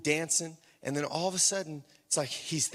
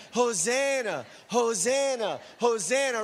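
high-pitched rallying Hosanna cry; loose, rousing praise; 5 semitones higher, 3 dB louder than usual. Shown as a demonstration. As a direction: get the congregation crying out along. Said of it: "we gotta get a little loose".

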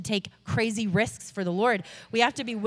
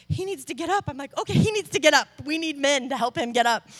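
higher, louder, hurried call for help; rally the men to lift him; urgent excitement; 5 semitones higher, 3 dB louder than usual. Retold or imitated. Rushing to help man stand.

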